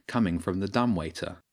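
ordinary delivery

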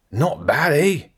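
put-on high, cocky rough Scottish accent; a swaggering rat in a rough Scottish accent. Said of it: "in a rough Scottish accent".

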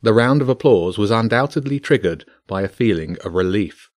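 usual speaking voice

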